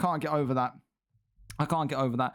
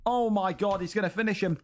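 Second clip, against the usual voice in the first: ordinary delivery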